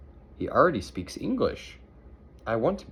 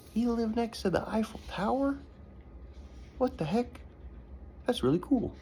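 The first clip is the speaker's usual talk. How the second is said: put-on much higher, wide-eyed child's voice; amazed kid voice; 14 semitones higher, 3 dB quieter than usual. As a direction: marvel like an awestruck girl. Nancy amazed at Robert's story.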